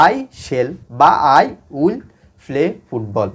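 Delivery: very slow, word by word; slow dictation. Shown as a demonstration. Teacher translating into future tense for students, slow dictation. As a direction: leave gaps so students can write.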